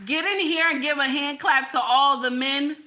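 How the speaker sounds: usual speaking voice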